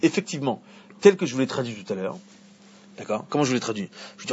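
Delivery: normal talking voice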